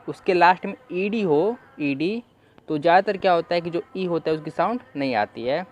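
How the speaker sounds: ordinary delivery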